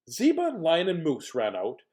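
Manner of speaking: ordinary delivery, nothing marked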